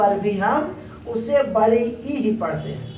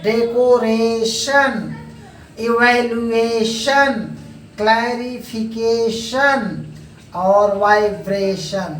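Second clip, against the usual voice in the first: each word drawn out very slowly; teacher modelling pronunciation, very slow; slow word drill; much slower than usual. Shown as a demonstration. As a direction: read each word slowly so students can copy it. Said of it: "teacher reads pronunciation word list".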